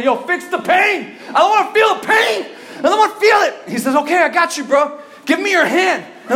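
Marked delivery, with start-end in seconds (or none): 0.0-3.5 high, loud hollering in acted agony; retold desperate shout. Telling his friend to fix the shoulder, desperate shout. 3.9-6.0 high, loud acted-out reassurance; friend's loud reply. Friend offering to fix the shoulder, loud and eager.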